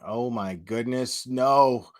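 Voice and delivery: rising into alarmed no, no; dismayed groan. Real, not acted. Streamer sees the alert coming, dismayed.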